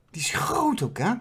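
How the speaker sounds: conspiratorial whisper. Real, not acted. Gossiping about the princess to viewers, whispered. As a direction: lean in and whisper it.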